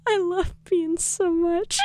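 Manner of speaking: soft, slow, choked-up mock sob; hammed-up tearful gush; fake crying. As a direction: pretend to be moved to tears. Mock-tearful gush over favourite band.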